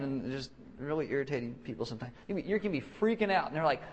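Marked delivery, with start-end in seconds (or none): none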